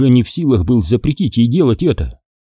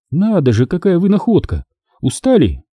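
Kira's voice; 7 semitones higher than usual. A narrator's reading. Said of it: "пытливо посмотрела".

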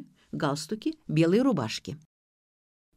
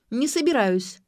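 higher, louder defiance; stubborn refusal; 5 semitones higher, 5 dB louder than usual. A narrator's reading. Ksyusha refusing to leave, stubborn refusal. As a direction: dig your heels in. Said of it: "упёрлась Ксюша".

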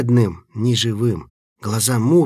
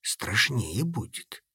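quiet fearful; 9 dB quieter, slower than usual. A narrator's reading. Frightened quiet.

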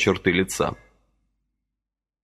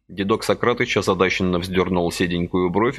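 ordinary delivery, nothing marked

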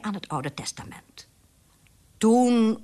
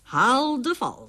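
slow, raised, higher order; commanding; 7 semitones higher, 5 dB louder, slower than usual. A narrator's reading. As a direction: order the household about, drawn out. Wife orders the mousetrap fetched.

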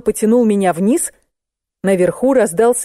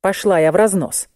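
ordinary delivery, nothing marked

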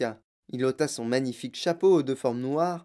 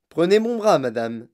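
gallant offer; 3 semitones higher, 8 dB louder than usual. A narrator's reading. Inventor offers his arm to the fallen lady, gallantly. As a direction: offer your arm with a flourish. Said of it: "dit galamment monsieur Wonka".

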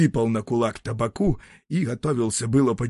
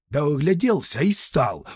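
usual speaking voice

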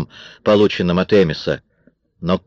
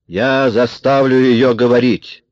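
slow, raised menacing voice; threatening; 5 semitones higher, 4 dB louder, slower than usual. A narrator's reading. Amis vows to make Marion talk, threatening. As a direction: press each word as a threat. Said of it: "угрожающе произнёс Эмис".

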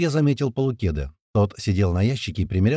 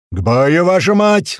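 loud, rising, furious command; battle roar; 8 semitones higher, 8 dB louder, slower than usual. A narrator's reading. Tuman ordering everyone to fight, roaring. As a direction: roar the men into combat. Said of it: "взревел Туман".

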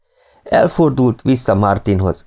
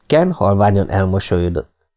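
plain reading voice

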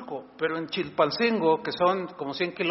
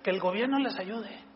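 mocking politicians; 8 semitones higher than usual.

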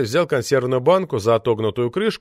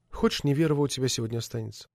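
whispering; 7 dB quieter, faster than usual. A narrator's reading. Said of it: "зашептала она".

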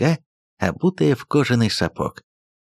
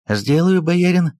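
high, eager bowing reply; obsequious servant; 8 semitones higher, 4 dB louder than usual. Acted. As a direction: answer like a fawning clerk. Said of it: "поклонился приказчик".

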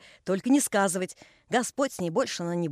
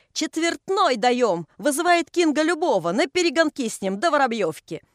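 loud, higher challenge called across the water; shouting out a challenge; 6 semitones higher, 4 dB louder than usual. A narrator's reading. The master's crowd shouting a bet to the workers, loud and challenging. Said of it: "А они кричат".

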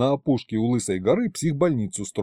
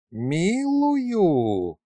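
hissed; 6 semitones higher than usual. A narrator's reading. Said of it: "прошипела Тамарка".

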